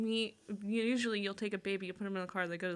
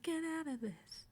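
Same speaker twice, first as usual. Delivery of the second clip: mock pleading; 7 semitones higher, 7 dB quieter than usual. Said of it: "It's like".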